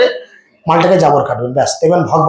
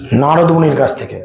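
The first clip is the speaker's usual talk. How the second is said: ordinary delivery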